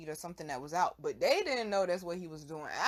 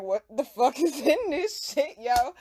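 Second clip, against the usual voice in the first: slow, loud, higher disbelief; imitating stunned friend; 5 semitones higher, 6 dB louder, slower than usual. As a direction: stare at the blunt in horror. Friend stares at blunt.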